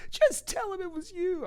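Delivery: sister pleading. Retold or imitated. Older sister urging her brother to confess, high pleading. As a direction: beg him urgently in a high put-on voice. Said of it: "She's like".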